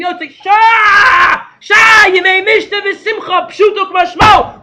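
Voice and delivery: high, loud, drawn-out Purim cries; ecstatic shout. Real, not acted. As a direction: roar it out in festive rapture. Ecstatic Purim teaching climax.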